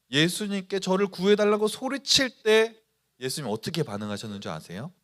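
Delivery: ordinary talk, nothing marked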